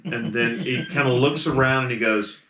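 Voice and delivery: ordinary talk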